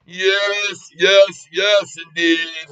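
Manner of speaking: gleeful yes. Real, not acted. Gloating over his stock trade.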